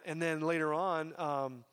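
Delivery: usual speaking voice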